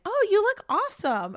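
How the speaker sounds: high, loud fake-sweet gushing; mock gushing. Everyone cheering her on, in a high loud mock gush. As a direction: gush it like an overeager friend cheering. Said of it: "everyone else is like".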